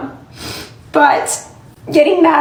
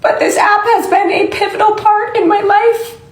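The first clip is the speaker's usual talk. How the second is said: crying; 6 semitones higher than usual. Real, not acted. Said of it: "I'm so sorry I'm crying".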